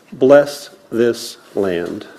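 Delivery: normal talking voice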